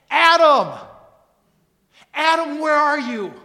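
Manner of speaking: loud high call across a garden; God's loud searching call. Acted. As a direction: call out for Adam as God searching. God calling out to Adam.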